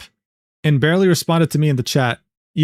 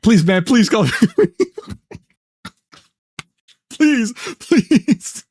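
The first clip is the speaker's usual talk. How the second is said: high, pleading whine with a laugh; mock begging; 6 semitones higher, slower than usual. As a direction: beg like a clingy partner. Streamer begging in mock character.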